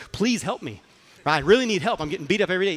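acted-out exasperated begging; pleading. Student begs master for help.

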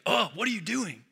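mock road rage at a bad driver; exasperated driver. Retold road rage at other drivers.

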